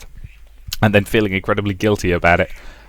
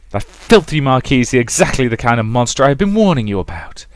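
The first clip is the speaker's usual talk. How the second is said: louder, higher, spitting fury; raging mother; 5 semitones higher, 4 dB louder than usual. Acted. Mother accusing Rosa of plotting behind her back, raging. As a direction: tear into her like a betrayed mother.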